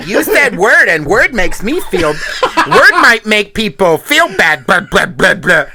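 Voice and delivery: loud, slowed mock whine through laughter; mocking whiny parody. Mocking complaint about word policing.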